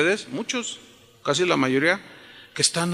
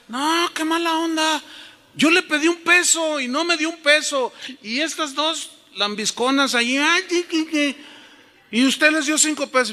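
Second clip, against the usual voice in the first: high-pitched indignant complaint; frustrated child; 9 semitones higher than usual. Retold or imitated. Boy complaining to dad about his sisters' money, high and indignant. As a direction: protest the unfairness like an aggrieved kid. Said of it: "yo me salía todo frustrado".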